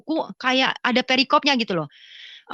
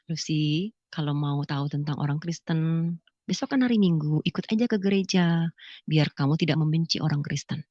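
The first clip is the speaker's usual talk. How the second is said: retold parent-in-law; 6 semitones lower, 4 dB quieter than usual. Parent-in-law inviting daughter-in-law to church, low and measured. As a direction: imitate a measured elder gently inviting. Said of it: "mertua saya bilang".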